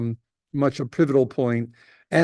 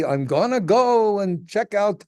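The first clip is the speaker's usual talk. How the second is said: adventurous settler; 6 semitones higher, slower than usual. Retold or imitated. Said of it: "might say".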